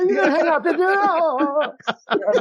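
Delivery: very high, hammed-up tearful plea; mock weeping. Overeager person finally picking up the phone, mock weeping. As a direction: pretend to be on the verge of tears. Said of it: "Immediately weeping".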